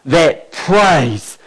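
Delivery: low, soft, intimate sermon voice; quiet earnest preaching. Acted. Preacher challenging the church about proper praise, low and hushed. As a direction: draw the congregation in, hushed and sincere.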